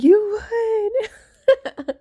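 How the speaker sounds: high, loud, laughing cheer; mock him shouting the news; imitating husband. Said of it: "And the hub's like".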